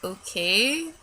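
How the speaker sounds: soft, held-back okay with a rise; reluctantly compliant. Retold or imitated. Client trying to be nice to the waxer, meekly compliant. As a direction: give in politely, swallowing the urge to yell. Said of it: "be a nice person and not yell".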